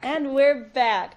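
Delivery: drawn-out TV host return; slow announcer. Pretend. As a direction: stretch it like a telethon host. Same line, same host voice.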